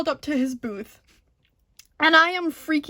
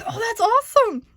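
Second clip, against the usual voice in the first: starstruck; 9 semitones higher, 4 dB louder than usual. Retold or imitated. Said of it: "So I'm like".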